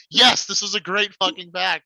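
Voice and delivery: high, loud burst of delight; excited re-enactment. Retold or imitated. Recalling ripping packs as a player, acted excitement. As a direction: crow over it as if reliving the win. Re-enacts joy at opening pack.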